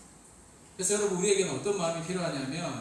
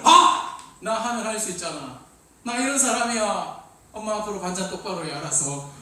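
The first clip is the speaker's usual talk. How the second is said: loud brag then lightly mocked arrogance; cocky kid; 7 dB louder than usual. Kid bragging and bossing mom about side dishes, mock arrogance. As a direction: swagger and boss your mom around.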